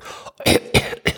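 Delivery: deliberately faked cough; fake cough. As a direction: cough to hide being out of breath. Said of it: "start making a fake cough".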